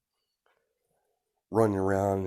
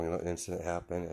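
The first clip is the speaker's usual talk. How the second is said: ordinary delivery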